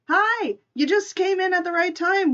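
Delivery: bright, high, eager hello to a newcomer; light up as a friend walks in; excited greeting. Real, not acted. Welcoming Art into the stream with an excited hello.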